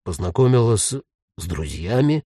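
halting low echo, breaking off and restarting; uncertain. A narrator's reading. Ghost repeats Jen's words back, unsure. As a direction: stumble into a doubtful question. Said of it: "неуверенно повторил призрак".

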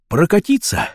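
high, tentative answer; unsure. Acted. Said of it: "неуверенно ответил Вася". (неуверенно, повыше)

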